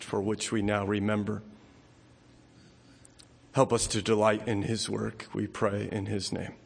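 low, soft, fading prayer; hushed closing prayer. Acted. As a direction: let the amen fade to a hush.

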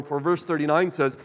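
ordinary talk

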